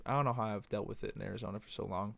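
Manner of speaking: ordinary delivery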